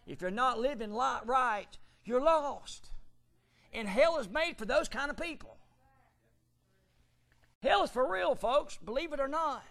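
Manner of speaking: high, loud fire-and-brimstone preaching; full-force preaching. Acted. Preacher thundering Paul's warning at the church, loud and high. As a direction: thunder the scripture at the congregation.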